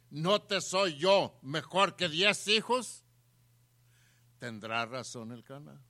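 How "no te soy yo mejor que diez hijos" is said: loud, high, slow rhetorical question; insistent husband. Acted. Preacher voicing the husband to his wife, insistent. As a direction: press each word as the husband's challenge. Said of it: "El marido le dice".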